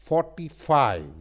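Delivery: Forty five with a pause and stress on each word; teacher showing two stressed beats in forty five; stress on both parts. Shown as a demonstration. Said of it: "रुक के फिर दोबारा stress".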